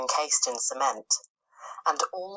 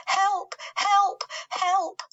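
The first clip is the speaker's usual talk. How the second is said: imitated calls for help; 3 dB louder, much slower than usual. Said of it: "we could hear her calling to us".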